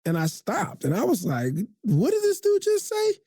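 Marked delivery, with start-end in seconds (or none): 1.8-3.1 high, incredulous inner reaction; can't believe what he just heard; stunned, high-pitched what-did-he-say; shocked disbelief. Retold or imitated. Retold shocked reaction to coach.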